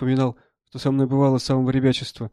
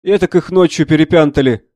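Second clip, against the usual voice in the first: raised voice, much louder; loud exclamation; 3 semitones higher, 9 dB louder than usual. Acted. Army doctor looking over the newly wounded, exclaiming loudly. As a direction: call it out as the doctor.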